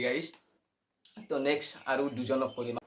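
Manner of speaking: ordinary talk, nothing marked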